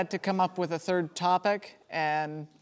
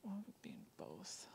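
whispering; 26 dB quieter than usual. Real, not acted. Thinking aloud to himself.

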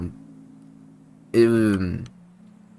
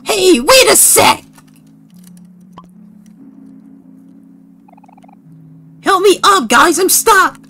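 high, pleading cartoon call; stuck game character; slower than usual. Stuck character calling friends for help, high plaintive voice.

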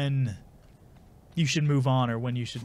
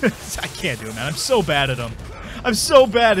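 ordinary delivery

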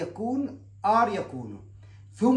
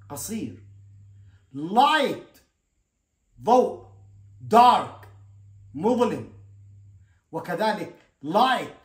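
each English word called out clearly, then the Arabic; teacher's drill reading; 4 dB louder, much slower than usual. Shown as a demonstration. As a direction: model each word loud and clear for the class. Vocabulary drill, English words modelled. (قراءة تعليمية واضحة للكلمات)

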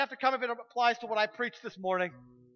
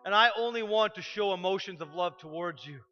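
closing prayer; 4 semitones lower than usual. Acted. Leads a prayer.

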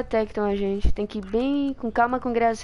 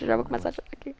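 whisper; 2 semitones higher, 8 dB quieter, much faster than usual. Pretend. Jokes he will cry.

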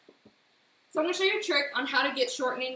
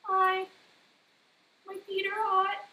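sheepish innocence; 9 semitones higher, slower than usual. Retold or imitated.

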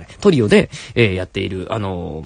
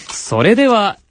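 programme title announcement; 8 semitones higher, slower than usual. Acted. Host introduces the confession story to listeners, announcer style. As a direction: present the story with stretched announcer phrasing.

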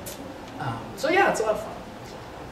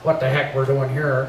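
a hint of a crabby Minnesota bar owner; crabby Gunner; 5 semitones lower than usual. Actor previewing his bar-owner role, lightly crabby and lower. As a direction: grumble it lightly, a bit lower. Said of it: "he's very, like, crabby".

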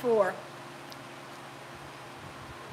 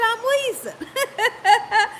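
slow drawn-out insistence; 8 semitones higher, much slower than usual. Retold or imitated. Telling the doctor she's wheezing, slow drawn-out insistence. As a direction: stretch out wheezing very slowly. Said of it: "I said".

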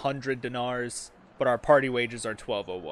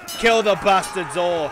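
shouted order; 6 semitones higher, 7 dB louder than usual. Acted.

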